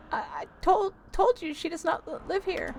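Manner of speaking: high, halting granny voice; stammering old lady; old lady tenant, insistent. Acted. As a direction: play a high-voiced old woman, put out.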